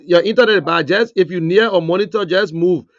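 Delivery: loud call over a bad line; shouting. Real, not acted. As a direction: raise the voice to be heard.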